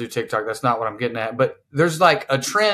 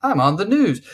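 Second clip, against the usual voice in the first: mock news-anchor delivery, pitched up; parody newscaster; 7 semitones higher than usual. Podcaster parodying TikTok talk to co-hosts, mock newscaster. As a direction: put on a sing-song on-the-news voice. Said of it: "as a parody of".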